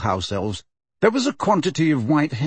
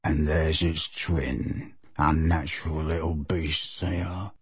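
low, slow muttered sneer; clear undertone; 7 semitones lower, 7 dB quieter, slower than usual. A narrator's reading. Kreacher sneering at George's twin, low and slow. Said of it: "adding in a clear undertone".

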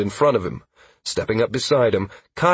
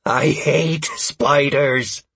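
slow, strained loathing; nearly unhinged; 5 semitones higher, much slower than usual. A narrator's reading. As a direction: drag out each word, close to breaking. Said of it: "on the verge of becoming unhinged".

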